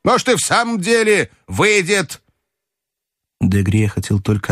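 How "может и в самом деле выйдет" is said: commanding grandmother. A narrator's reading. Grandmother ordering the narrator to bet, high and commanding.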